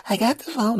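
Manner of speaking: put-on very high childish voice; eager little nephew; little kid voice. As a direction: say it like a proud small boy. Nephew answers.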